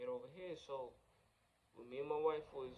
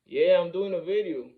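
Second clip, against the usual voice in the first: real raised voice, higher and much louder; calling out; 6 semitones higher, 18 dB louder than usual. Real, not acted. Vlogger interrupted mid-video answers someone, calling out loudly. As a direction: break off and call to someone nearby.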